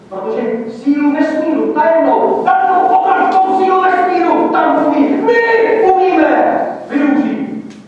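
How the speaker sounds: loud, high, grand proclamation; swelling national pride, drawn out at the peak; proud. Acted. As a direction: proudly build to a ringing boast. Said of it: "A já říkám, hrdě".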